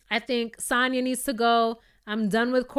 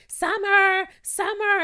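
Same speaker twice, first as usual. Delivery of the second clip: high, loud mock call of Summer; calling out through the door; 10 semitones higher, 5 dB louder, slower than usual. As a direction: yell a name through a hotel door. Marlo banging on door calling.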